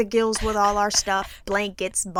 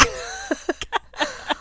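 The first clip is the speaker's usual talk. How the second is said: high laugh; 5 dB quieter than usual. Real, not acted. Laughing at retelling.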